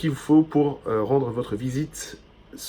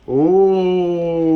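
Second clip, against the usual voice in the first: excited; 4 semitones higher, 5 dB louder than usual. Real, not acted. Delighted discovery while unboxing.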